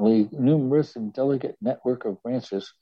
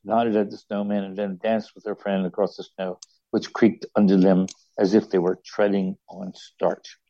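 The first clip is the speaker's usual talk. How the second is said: usual speaking voice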